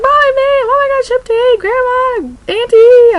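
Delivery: very high, loud squeals of joy; joyful squealing. Retold or imitated. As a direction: squeal the good news to the whole family. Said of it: "jumping around for joy".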